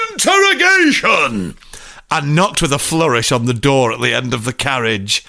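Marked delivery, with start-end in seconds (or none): 0.1-1.6 high, drawn-out showy shout; stretched, hammed-up yell; X Factor announcer. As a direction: announce it like a talent-show act. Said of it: "It's like X Factor".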